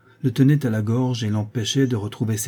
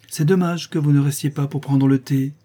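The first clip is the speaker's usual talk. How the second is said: higher, louder, openly voiced; 5 semitones higher, 5 dB louder than usual. A narrator's reading. Lissa speaking up to the departing prince, out loud. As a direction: speak up clearly after a private thought. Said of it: "ajouta-t-elle tout haut".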